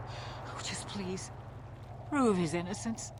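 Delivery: soft, low pleading. Acted. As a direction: beg softly for help. Wife begs for help.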